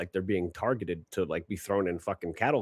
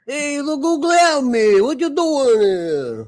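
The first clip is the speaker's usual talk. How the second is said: loud, high, drawn-out Italian caricature; mock Italian accent; 11 dB louder than usual. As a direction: holler a greeting like a hammed-up Italian. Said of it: "Is it like a fucking Italian?".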